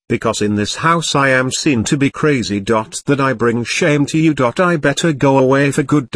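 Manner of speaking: ordinary delivery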